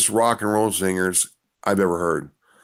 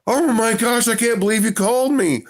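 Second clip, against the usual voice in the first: high squealing excitement, much higher; excited fan. Retold or imitated. Fan on FaceTime gushing to Ken, high excited squeal. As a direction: gush like a starstruck fan. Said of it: "he's like".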